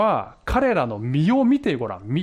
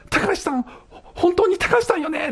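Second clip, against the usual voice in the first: mock caller, much higher and insistent; heated-up caller; 13 semitones higher than usual. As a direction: press her question, getting worked up. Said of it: "お互いすっごいヒートアップしてきて". (興奮して高い声で問い詰める女性)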